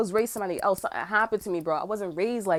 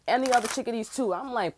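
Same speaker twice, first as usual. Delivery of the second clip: ordinary delivery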